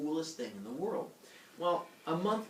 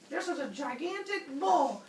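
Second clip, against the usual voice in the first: high, strained proclamation; hammed-up public announcement; showy announcer; 13 semitones higher than usual. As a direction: proclaim it to sell a bad lie. King fakes size for Poseidon.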